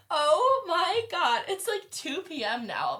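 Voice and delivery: high, loud, drawn-out astonishment; exaggerated disbelief. Reacting to his seven-hours-later text, exaggerated disbelief. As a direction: exclaim slowly in hammed-up shock.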